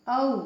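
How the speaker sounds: औ glided from अ into ऊ; demo vowel औ. Shown as a demonstration. Teacher showing students the औ glide, open mouth. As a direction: slide अ into ऊ for औ. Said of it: "अ और ऊ का साउंड निकलता है".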